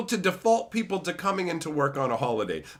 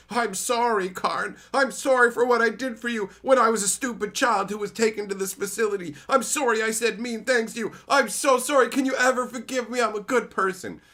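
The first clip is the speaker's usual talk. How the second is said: mock Teferi apology; 7 semitones higher, 4 dB louder than usual. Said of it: "Teferi was all like".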